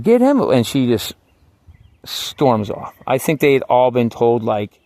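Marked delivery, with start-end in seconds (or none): 0.0-0.4 high, loud yelled get him; shouted order. Retold or imitated. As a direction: bark it at her, full volume. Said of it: "And I'm like".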